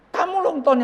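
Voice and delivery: high, loud exclamation; insistent retort. Retold or imitated. Old pastor tells Ton to pray for him, insistent. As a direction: throw the request straight back, loudly. Acted retort about who prays.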